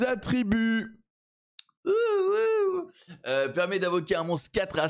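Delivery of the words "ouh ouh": high whooping "ouh ouh"; excited high hoot. Real, not acted. Excited by legendary scroll reward.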